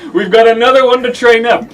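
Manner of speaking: grandiose flea circus showman. Acted. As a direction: announce it like a circus ringmaster. Showman voice in story.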